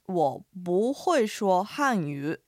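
very slow, syllable by syllable; slow Mandarin teaching read; slow model phrase. Shown as a demonstration. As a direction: lay out each tone slowly for learners. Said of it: "では解説していきますね".

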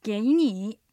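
"给你" with rising then dipping tones, clear; Chinese model phrase. Shown as a demonstration. Teacher repeating the phrase for listeners, clear Mandarin. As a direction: model "给你" crisply.

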